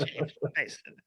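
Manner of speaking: strained, pinched British accent on the name; constipated British accent. Shown as a demonstration. Joking host demos a British accent for James Mason. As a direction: say it like a constipated Englishman. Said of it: "the great way to do a British accent is you just pretend you're constipated on the toilet".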